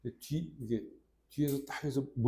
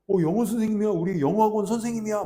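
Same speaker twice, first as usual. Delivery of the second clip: high, louder proud announcing; kids showing off; 7 semitones higher, 6 dB louder than usual. Retold or imitated. Students introduce their teacher to friends, proud high voice. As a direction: introduce him proudly like a child. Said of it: "막 하면서 막 또 소개를 하는 거야".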